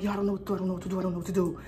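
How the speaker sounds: quiet, very fast panicked babble; panicked babbling. Retold or imitated. Hiding in the room telling the girl, quiet fast panic. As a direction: rattle it off in a hushed panic. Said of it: "I'm like".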